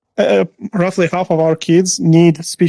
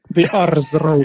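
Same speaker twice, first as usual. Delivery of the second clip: put-on Russian accent; slower than usual. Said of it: "In communist Soviets".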